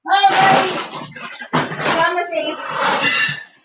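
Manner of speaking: high, slow cooing pet voice. Real, not acted. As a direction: coo tenderly at the cat. Cooing to cat.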